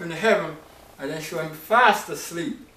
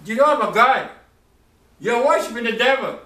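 louder, higher firm condemnation; stern rebuke; 6 semitones higher, 4 dB louder than usual. Retold or imitated. Elijah rebuking Baal's worshippers, stern and loud. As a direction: declare it like a prophet. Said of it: "And Elijah said".

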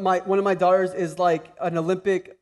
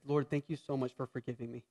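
prayerful demo; 7 semitones lower, 13 dB quieter than usual. Shown as a demonstration. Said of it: "I have to do this".

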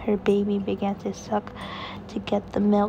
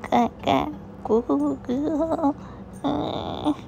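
put-on baby babble; 5 semitones higher, slower than usual.

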